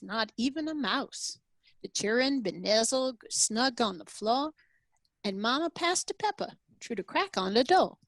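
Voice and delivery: put-on a Louisiana Cajun storyteller's accent, lightly done; gentle Cajun accent on a Christmas poem. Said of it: "I practiced the Cajun accent".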